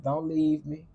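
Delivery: ordinary talk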